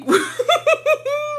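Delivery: high-pitched giggle fit; helpless high laugh; high squealing laugh. Real, not acted. Bursts out laughing at the memory.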